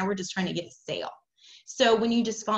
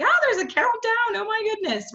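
much higher, louder squeal of delight; thrilled buyer; 13 semitones higher, 5 dB louder than usual. Retold or imitated. Customer spotting the countdown, squealing with delight. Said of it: "people are like".

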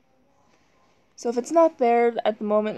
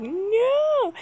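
yelling; 12 semitones higher than usual. Retold or imitated. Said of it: "they're gonna be like".

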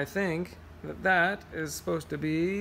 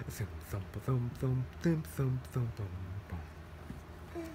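lower, softer bass thumps slowing down; 4 semitones lower, 7 dB quieter, slower than usual. Acted. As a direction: thump it like a fading double bass. Bass sound in story.

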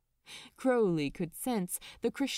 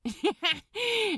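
high, loud wicked cackle; 10 semitones higher, 4 dB louder than usual. Acted. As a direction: cackle with glee. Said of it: "Crowley cackled at that".

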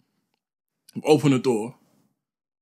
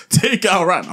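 higher, louder stern dad command; mock dad order, louder; angry dad; 8 semitones higher, 8 dB louder than usual. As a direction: bark it like an angry father. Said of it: "when they're angry".

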